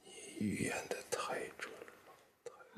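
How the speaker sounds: hushed, bitter muttered accusation; whispered sarcasm. Acted. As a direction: breathe it out in cold reproach. Father bitterly blames daughter.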